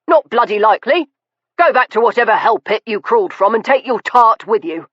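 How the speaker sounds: father-in-law driving off his son-in-law; harsh contempt. Acted. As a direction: spit the insult out fast. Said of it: "he gave a harsh laugh".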